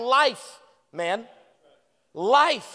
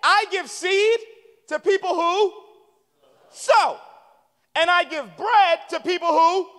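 higher and louder, light voice of God; voicing God; 5 semitones higher, 5 dB louder, slower than usual. Pastor voicing God explaining who gets seed, lightly raised. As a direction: play God casually laying out the rule. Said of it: "God's like".

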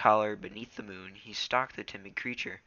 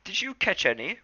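high, eager question; Rusty voice; 10 semitones higher, 6 dB louder than usual. Acted. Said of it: "Rusty asked".

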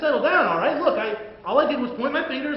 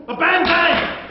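mock gunshots; 8 semitones higher, 6 dB louder, much slower than usual. Fake gunshot in sketch.

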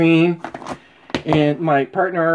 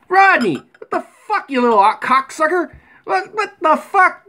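shouting at the bird; 8 semitones higher than usual. Real, not acted. Yelling at the bird Rodney.

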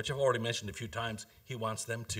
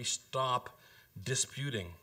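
normal talking voice

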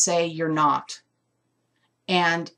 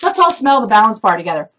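rallying call; 3 semitones higher, 10 dB louder, faster than usual. Real, not acted. Rallying viewers to smell the soap.

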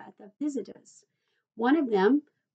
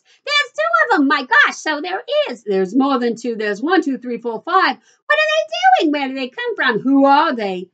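excited shouting; 3 semitones higher, 9 dB louder than usual. A narrator's reading. Children and parents spot the little men, shouting excitedly. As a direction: shout questions over one another in astonishment. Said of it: "everybody started shouting at once".

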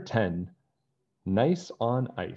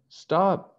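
high, louder yell of stop; 11 semitones higher, 5 dB louder than usual. Acted. Nancy yelling after the running snowman. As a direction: call out after him to stop. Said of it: "Nancy yelled".

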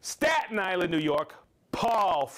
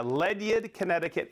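normal talking voice

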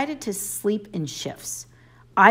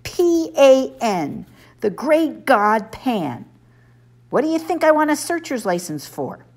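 indignant spelling out; 9 semitones higher, 4 dB louder than usual. A narrator's reading. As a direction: spell it out like scolding a fool. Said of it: "he cried indigently".

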